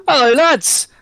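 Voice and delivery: loud, high, chirpy pub landlord; cheery landlord greeting. Acted. Pub landlord greeting the players, loud and cheery. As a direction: boom a bright hello to the lads.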